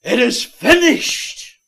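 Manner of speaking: high, drawn-out triumphant shout; loud cry. Retold or imitated. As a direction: cry it out at full voice, slowly. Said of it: "loud voice".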